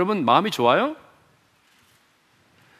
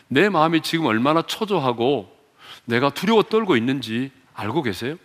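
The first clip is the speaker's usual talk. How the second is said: lower, hushed pleading to God; anxious prayer; 6 semitones lower than usual. Acted. Voicing a believer's anxious prayer.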